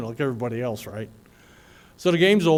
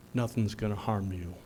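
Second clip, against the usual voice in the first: softer, lower, fading vow; solemn promise; 6 semitones lower, 8 dB quieter than usual. Retold or imitated. Lieutenant quoting the guardians' vow, softly solemn. Quoted promise, closing the speech.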